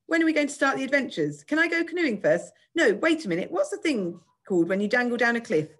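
put-on high, eager girl bursting with questions; impatient, high-pitched kid; excited child. A narrator's reading. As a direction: bubble over with excitement.

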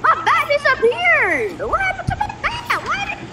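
high whiny voice. Pretend. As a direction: whine like a frustrated child. Whining about lost bat.

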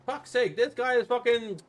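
loud, frustrated outburst; exasperated cursing. Real, not acted. Frustrated rant at caller.

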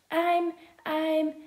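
panting from running, stumbling start; out of breath. A narrator's reading. Jake after running to the bus, lightly out of breath. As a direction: gasp the words out, mildly winded. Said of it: "tries to catch his breath".

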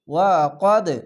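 কদে with the দ bounced hard and dropping; qalqala bounce. Shown as a demonstration. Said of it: "এখানে কলকলা ধাক্কা মেরে নিচে sound আসবে". (ওয়া কদে কলকলা ধাক্কা দিয়ে)

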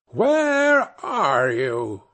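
very slow, eerie witch voice; drawn-out witch call. A narrator's reading. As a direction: stretch every word like a witch. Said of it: "Then she cried again".

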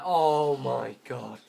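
loud, slow cry of exasperation; frustrated shout. Real, not acted. Player having a bad day on cod, exasperated. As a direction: drag it out loudly, fed up.

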